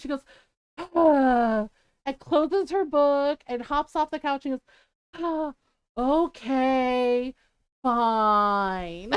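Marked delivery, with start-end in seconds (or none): 0.8-1.7 loud, heaved mock sigh; imitated huffy child's sigh; exaggerated sigh. Scolded daughter told to apologize, sighing heavily. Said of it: "And she just sighs". 5.2-9.1 long, drawn-out mock grudging agreement; sigh, then drag out each word, sinking low; sighing reluctance. Scolded daughter hopping off the couch, grudgingly giving in. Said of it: "And she just sighs".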